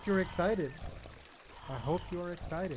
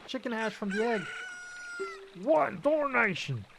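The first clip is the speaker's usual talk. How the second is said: put-on higher country-bumpkin accent, grumbling awake; 7 semitones higher than usual. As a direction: play a flustered rustic farmhand woken up. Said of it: "Excellent voice acting".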